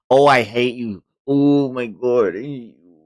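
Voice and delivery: ordinary talk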